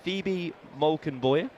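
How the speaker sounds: put-on slow, careful try at a Dutch accent; drawn-out mock Dutch pronunciation of the name; Dutch accent attempt. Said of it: "how's your Dutch accent?".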